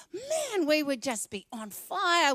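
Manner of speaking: pumped, man leaps up high; excited outburst. Real, not acted. Preacher imagining Brisbane revival, excited. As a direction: burst out with zeal.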